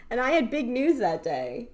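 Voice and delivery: ordinary talk, nothing marked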